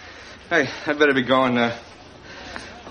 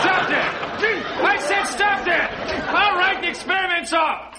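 shouting; 14 semitones higher than usual. Acted. Shocked repeatedly, yelling to stop.

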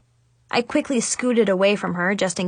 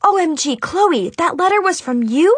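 loud, high, shocked question; acted high-pitched shock; practically screaming; 8 semitones higher, 4 dB louder than usual. A narrator's reading. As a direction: burst out in astonished realization. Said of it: "I practically screamed".

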